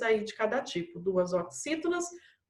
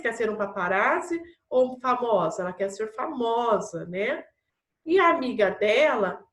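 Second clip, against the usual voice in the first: normal talking voice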